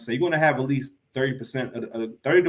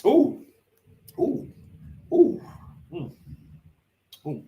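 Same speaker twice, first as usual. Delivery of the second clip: sharp gasping coughs after swallowing wrong; choking on a drink; 11 semitones higher, slower than usual. Real, not acted. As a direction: sputter and gasp as a drink goes down the wrong pipe. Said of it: "That went down the wrong pipe".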